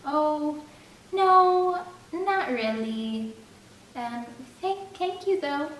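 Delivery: put-on high, flustered polite girl voice; Jamie's polite refusal. A narrator's reading. Jamie turning down Margaret's beer, high and polite. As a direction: decline sweetly, a little thrown.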